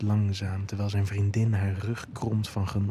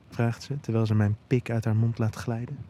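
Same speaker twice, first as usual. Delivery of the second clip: plain reading voice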